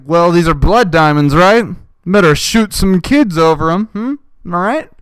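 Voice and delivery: mock the commenter's logic loudly; jeering sarcastic impression; sarcastic mockery. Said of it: "It's like".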